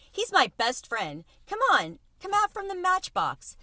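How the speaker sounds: high, eager coaxing; coaxing mouse voice. Acted. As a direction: call him out cheerfully. Tucker coaxing Chester out.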